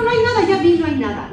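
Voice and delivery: high, loud retort imitating a little sister; loud denial. Little sister insisting the monster isn't there, loud denial. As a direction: re-enact an insistent, shouted denial.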